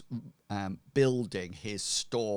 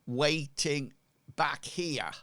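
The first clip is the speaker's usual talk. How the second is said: higher-pitched, hesitant craftsman; lightly voiced shopkeeper showing his work; blacksmith; 6 semitones higher, 3 dB louder than usual. Blacksmith invites customers to the rear of shop, lightly acted. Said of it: "he says".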